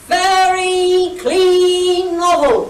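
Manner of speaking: high, loud, very drawn-out vendor's cry; long sing-song market-trader yell; yelled sales pitch. Retold or imitated. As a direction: holler it like a street seller, almost singing. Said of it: "I yell at the passing delegates".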